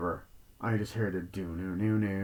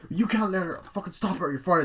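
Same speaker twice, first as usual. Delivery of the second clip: high, heated yelling in a retold fight; angry yelling; 9 semitones higher than usual. Boyfriend yelling at the producer, high heated anger. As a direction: snap at him, furious and high.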